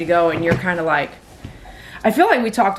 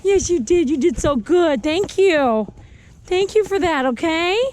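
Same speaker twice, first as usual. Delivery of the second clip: talking to a dog; 10 semitones higher than usual. Real, not acted. Groomer praising dog.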